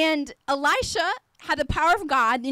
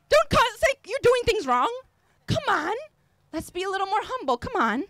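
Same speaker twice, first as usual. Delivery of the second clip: ordinary delivery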